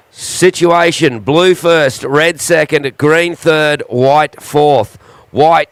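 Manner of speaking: slow, clear PA call to surfers; surf contest announcer. Acted. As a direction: announce the heat standings.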